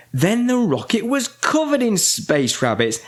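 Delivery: ordinary delivery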